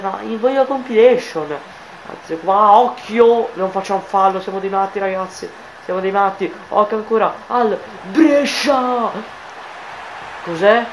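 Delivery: usual speaking voice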